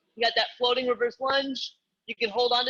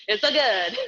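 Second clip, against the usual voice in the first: playful, lightly hammed Mario Italian accent; 4 dB louder than usual. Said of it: "I have, like, Mario in my head".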